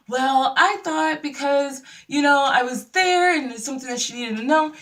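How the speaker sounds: imitating someone sheepishly explaining herself; defensive excuse. Acting Tanya's defensive excuse.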